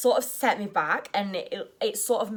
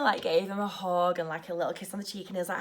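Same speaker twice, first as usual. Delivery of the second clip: ordinary delivery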